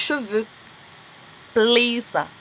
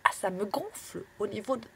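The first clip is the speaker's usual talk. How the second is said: whisper; 10 dB quieter than usual. Real, not acted. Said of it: "J'ai vraiment une voix bizarre là".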